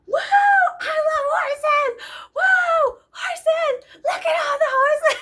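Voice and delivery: high, loud laughing screams; screaming. Retold or imitated. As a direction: cheer wildly for the horses. Shouting out the sunroof.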